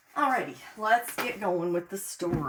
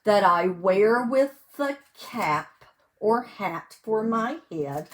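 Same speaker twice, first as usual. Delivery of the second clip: loud, sing-song picture-book reading; reading to children; 8 dB louder, slower than usual. Acted. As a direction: read each page big and bright for little listeners. Reads picture book aloud.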